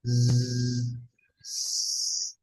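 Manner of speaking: long held zzz, fading to breath; sustained buzzing z. Shown as a demonstration. Teacher holding a buzzing z to show air flowing. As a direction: stretch the z out so the air keeps flowing. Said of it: "udaranya masih bisa ngalir".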